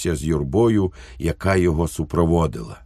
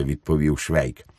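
plain reading voice

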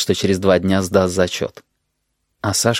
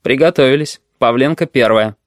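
dry, businesslike call-up; curt examiner, slightly raised voice; 3 semitones higher than usual. A narrator's reading. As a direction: call the next student with dry authority. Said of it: "как он сухо сказал".

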